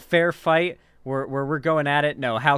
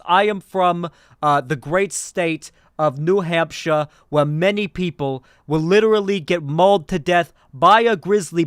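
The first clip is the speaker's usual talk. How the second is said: loud, emphatic politician impression; Bernie; 5 dB louder than usual. Streamer playing a candidate defending gun rights, loud Bernie impression. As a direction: belt it out like an indignant debate speech. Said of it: "I'm doing a Bernie impression".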